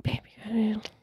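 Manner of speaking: soft unvoiced whisper. Telling a friend about food in her teeth, whispered. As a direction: whisper it discreetly so nobody hears. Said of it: "I'd be like".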